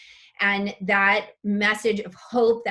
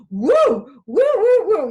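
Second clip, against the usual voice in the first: loud high whoops of joy; cheering; 9 semitones higher, 10 dB louder, slower than usual. Real, not acted. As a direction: whoop like a delighted fan. Cheering a viewer's comment.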